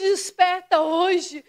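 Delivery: ordinary talk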